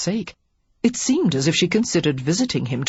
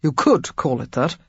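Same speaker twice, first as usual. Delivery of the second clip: dry; 3 semitones lower than usual. A narrator's reading. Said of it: "he said dryly".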